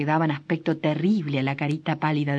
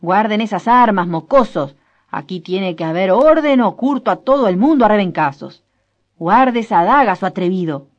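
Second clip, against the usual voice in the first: much louder furious yelling; angry shout; 3 semitones higher, 10 dB louder than usual. Acted. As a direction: threaten the rowdy youths at full volume. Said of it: "se oyó gritar a Frutos Pareja". (grito furioso)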